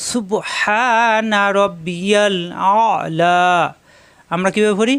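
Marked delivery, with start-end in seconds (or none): 0.1-3.4 drawn-out tajweed recitation; chanted model reading; slow correct recitation. Shown as a demonstration. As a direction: stretch every sound of সুবহানা রব্বিয়াল আলা. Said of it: "এখানে দেখুন".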